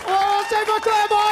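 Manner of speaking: loud, high announcement of the act; calling out. Real, not acted. Host calling the crowd to cheer the act.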